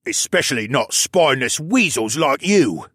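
contemptuous prisoner's retort; snapping. A narrator's reading. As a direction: snap it at a cowardly companion. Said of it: "the other one snapped".

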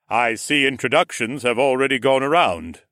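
big, hearty nobleman; booming lord. A narrator's reading. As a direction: announce yourself grandly on arrival. Lord arrives, greets the group.

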